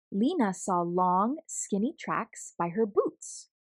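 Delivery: plain reading voice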